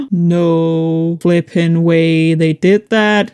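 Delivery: slow, drawn-out astonished gasp; stunned disbelief. Real, not acted. Huge discovery.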